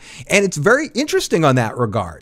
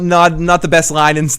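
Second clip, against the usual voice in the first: normal talking voice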